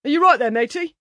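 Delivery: high, loud workman's call. Acted. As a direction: sing it out across the garden. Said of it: "Someone sang out".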